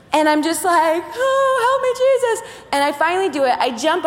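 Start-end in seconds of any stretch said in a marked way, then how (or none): 1.1-2.4 high, sing-song cry for help; comic frightened prayer before jumping; mock desperate plea. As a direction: plead to the heavens half-joking. Scared prayer before the jump.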